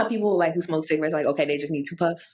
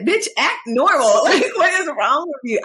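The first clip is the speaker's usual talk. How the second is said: high, louder laughing self-scold; scolding herself; 7 semitones higher, 5 dB louder than usual. Retold or imitated. Scolding herself for acting strange, laughing high. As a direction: snap yourself out of it with a laugh. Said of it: "and I'm like".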